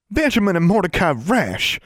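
high-pitched, indignant scolding; outraged cry, pitched well up; outraged exclamation. A narrator's reading. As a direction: call out both names, scandalized. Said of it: "exclaimed Constance, outraged".

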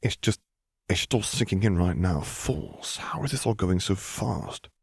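soft, slow, shaken voice; quiet. A narrator's reading. Zev quietly answering Luke, soft and slow. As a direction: hush it out, barely above a breath. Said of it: "Zev answered just as quietly".

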